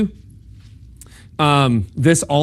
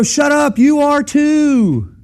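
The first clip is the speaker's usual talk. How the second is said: high, drawn-out mock yell; indignant car-to-car shout; shouted retort; 12 semitones higher, 4 dB louder, much slower than usual. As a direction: holler it back at the other driver, slowly. Acted reply shouted between moving cars.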